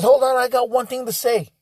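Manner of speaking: lightly voiced guest demanding attention; imitating the gentleman. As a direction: announce it like he's about to make a toast. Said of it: "He says".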